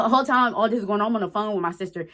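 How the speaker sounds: ordinary delivery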